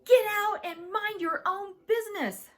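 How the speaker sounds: high, loud dismissal; angry shout. A narrator's reading. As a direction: shout them out of the room. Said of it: "I shouted".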